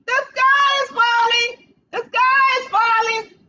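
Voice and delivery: squeaky panicked chick. Acted. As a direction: squeak it out like a frightened little hen. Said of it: "She popped up squeaking".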